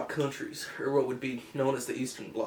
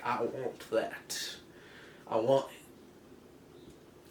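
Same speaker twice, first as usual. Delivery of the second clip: very slow, softer, drawn-out wanting; covetous mock voice; 2 semitones lower, 5 dB quieter, much slower than usual. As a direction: draw each word out slowly, covetously. Allies covet Berlin in retold talk.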